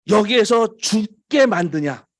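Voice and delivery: preacher's forceful mock protest; acted complaint. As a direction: protest as the aggrieved people, drawn out.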